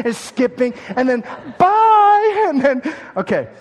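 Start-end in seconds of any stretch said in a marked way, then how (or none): 1.6-1.9 high, loud sing-song bye; sing-song farewell. Retold or imitated. Perfect mom waving her kids off, high sing-song.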